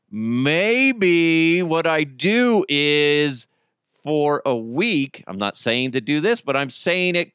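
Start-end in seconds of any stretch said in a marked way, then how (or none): 0.1-5.2 very slow, drawn out; slow teasing drawl. Real, not acted. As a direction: stretch it out teasingly.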